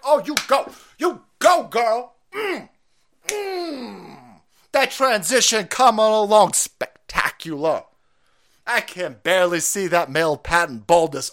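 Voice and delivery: high, sassy hammed-up praise; mock sassy cheerleader. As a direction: gush like a sarcastic cheerleader.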